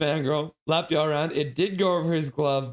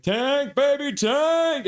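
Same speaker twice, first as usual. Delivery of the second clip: excited cheer; 11 semitones higher, 4 dB louder, much slower than usual. Retold or imitated. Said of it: "Connie's like".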